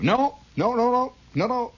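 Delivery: high, loud rapid no's; insistent interrupting. Retold or imitated. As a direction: cut him off sharply. Interviewer cutting off Lodge.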